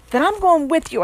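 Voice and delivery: mild choked voice. A narrator's reading. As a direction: force words through a tight throat. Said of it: "I choked".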